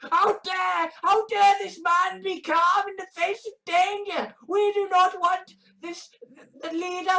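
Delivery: high-pitched, affronted protest; mock outrage. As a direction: put on a high voice of scandalized objection. Parodying outraged objectors to calm leader.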